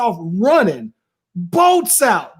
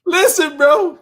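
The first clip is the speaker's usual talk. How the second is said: ordinary talk, nothing marked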